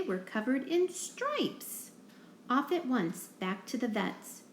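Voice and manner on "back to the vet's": plain reading voice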